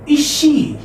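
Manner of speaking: high rising Is she? with a long E; teacher demonstrates surprised Is she?. Shown as a demonstration. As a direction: ask in disbelief, stretching she. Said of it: "You say with surprise".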